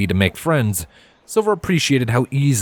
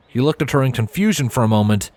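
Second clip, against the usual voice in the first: usual speaking voice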